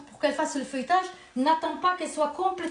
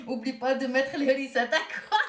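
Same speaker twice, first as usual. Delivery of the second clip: en riant. Real, not acted. Taquine en riant.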